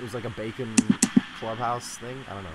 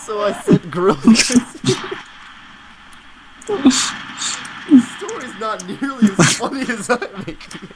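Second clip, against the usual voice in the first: laughing so hard it goes high and breaks; helpless laughter through the words; real tears of laughter while telling it; crying laughing; 8 semitones higher, 4 dB louder, slower than usual. Real, not acted. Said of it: "I'm crying".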